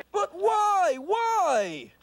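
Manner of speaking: high, drawn-out wail of protest; plaintive protest. Acted. Alf protests his punishment to the family, plaintive protest. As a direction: plead theatrically against the punishment.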